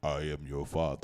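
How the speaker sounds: low quiet Vader rumble; Darth Vader. Retold or imitated. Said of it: "Darth Vader".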